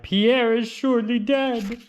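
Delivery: high, wailing cry of grief; cried out. A narrator's reading. Parents find lion, cry out in high grief. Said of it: "and cried".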